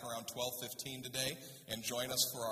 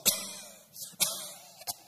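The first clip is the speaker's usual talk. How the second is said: exaggerated smoke-choked coughing; acted coughing fit. Exhausted crew after smoke inhalation, acted coughing. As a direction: hack and wheeze as if full of smoke. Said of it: "we're all just".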